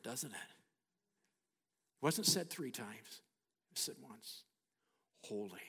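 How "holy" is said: deep, soft rumble on Holy; low, quiet, awe-filled Holy; hushed thundering. Acted. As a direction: imitate distant thunder, held back and low. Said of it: "thundered the heavens".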